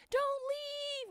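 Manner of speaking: high, drawn-out mock wail; imitated scream. Aunt imitating her niece at concert's end, screaming plea. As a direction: squeal it in imitation, without volume.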